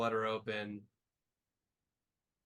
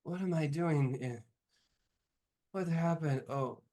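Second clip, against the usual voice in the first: higher-pitched, lightly groggy character; groggy waking voice; 4 semitones higher than usual. Acted. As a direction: play someone just coming to. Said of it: "In a groggy sort of".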